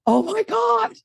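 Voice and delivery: sudden high, thrilled exclamation; a burst of delighted surprise; amazed gasp. Retold or imitated. [name] replays her reaction to David, high and amazed. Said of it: "And I was like".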